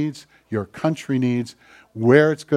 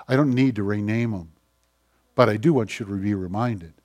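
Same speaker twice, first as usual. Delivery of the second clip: ordinary talk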